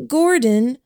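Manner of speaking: lightly voiced hesitant girl; Shipman voice. A narrator's reading. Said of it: "then switched for Shipman".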